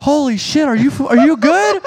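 high alarmed passerby voice; mock shocked passerby. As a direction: sound like a stunned stranger on the sidewalk. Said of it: "Someone walking is like".

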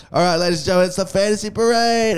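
lightly hammed-up club announcer; he introduces the dancers, half-hearted emcee voice; mock MC announcement. He announces the parade.

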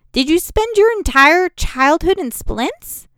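high, loud, slow disbelief; incredulous. Acted. Raina, aghast at Bannon's injuries, asks high, loud and slow. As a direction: demand it, still aghast. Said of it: "I demanded incredulously".

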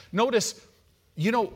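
ordinary delivery

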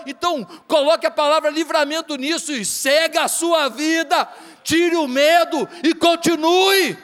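mais alto e mais forte, pregando com fervor; pregação inflamada. Real, not acted. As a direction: pregue subindo com força até o fim. Pregação com força crescente. (pregação fervorosa e bem mais alta)

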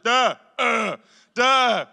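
put-on high, loud toddler grunts; toddler babble. Dad imitating his two-year-old son's speech, toddler babble. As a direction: blurt out single syllables like a two-year-old. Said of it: "Abel at two is like".

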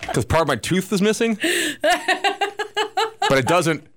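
laughing. Real, not acted. Laughing through explanation.

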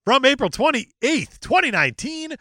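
high, emphatic announcer-like read; dramatic review read. Acted. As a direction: declaim the user review with big pitch swings. Reads an online review aloud.

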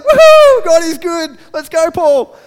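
high whooping cheer, very loud; whoop, then urge your mate along loudly; excited Silas. Acted. Silas celebrating with Paul in the jail, jubilant whooping. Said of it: "I just imagine Silas going to Paul".

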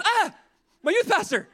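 mock horrified squeal, very high and loud; terrified shriek. Freshman spots her youth pastor on a cruise, horrified. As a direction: imitate a teen's shocked outburst. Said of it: "She was so terrified to see me".

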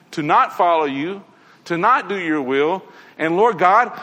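normal talking voice

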